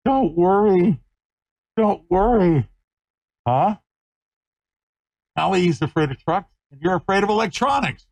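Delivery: high, soothing puppy talk. Real, not acted. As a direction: reassure the puppy in a high coo.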